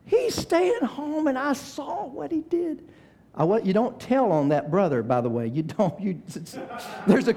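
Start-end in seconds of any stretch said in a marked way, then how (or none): 0.0-2.9 put-on kid's tattling whine. Little brother complains his brother fakes sickness, high slow whine. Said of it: "And I'm like".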